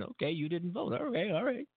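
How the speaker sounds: high, soft resigned okay; a light mock shrug of acceptance. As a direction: brush it off with a sigh. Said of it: "you say".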